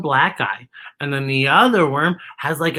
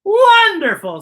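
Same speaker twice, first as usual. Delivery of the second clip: high, loud wonderful; delighted worm; 13 semitones higher, 6 dB louder, slower than usual. A narrator's reading. Worm delighted by the belt idea, high and loud. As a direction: cheer the idea. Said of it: "says Worm".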